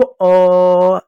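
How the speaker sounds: "अ" held long and level; lingering अ sound. Shown as a demonstration. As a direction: sustain "अ" to show the hidden vowel. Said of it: "ऐसा सुनाई दिया".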